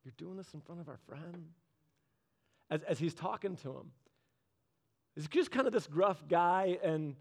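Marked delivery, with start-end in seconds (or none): 0.0-1.5 muttered under the breath, much softer; a mortified teen mumbling; hushed embarrassed aside; embarrassed kid's look. Retold or imitated. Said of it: "watching his kid's countenance".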